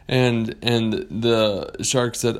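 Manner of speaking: usual speaking voice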